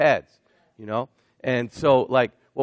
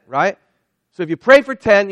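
ordinary talk

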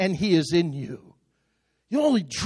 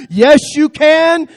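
high, loud, slowed preaching shout; rousing shout; 7 semitones higher, 9 dB louder, slower than usual. Real, not acted. Preacher shouting the refrain to fire up the church. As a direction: belt the refrain out to lift the room.

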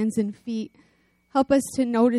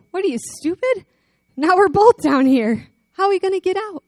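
higher, louder incredulous outburst; exasperated disbelief; 8 semitones higher, 7 dB louder than usual. Retold or imitated. Man in the hole shocked that his friend jumped in. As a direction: blurt it out in disbelief. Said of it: "guy looks at him and goes".

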